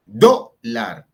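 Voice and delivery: dólar split, with a loud DÓ; DÓ... lar, punching the first syllable; stress on penultimate syllable. Shown as a demonstration. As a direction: hit the front of dólar hard, then pause. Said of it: "llevan la fuerza en la penúltima sílaba".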